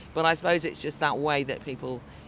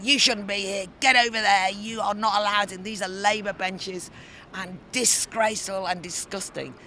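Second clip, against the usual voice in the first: retold angry shout; 7 semitones higher, 7 dB louder than usual. Lords shouting her off benches.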